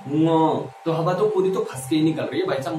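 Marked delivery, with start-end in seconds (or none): 0.1-0.6 long "ङ" through the nose; nasal ङ. Shown as a demonstration. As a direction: model "ङ" slowly as a nasal hum-like syllable. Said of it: "ऐसे बोलो".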